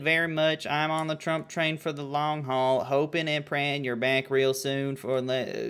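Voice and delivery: put-on southern letter voice; bad southern accent. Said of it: "My southern accent is just, uh, fucking Dale Gribble".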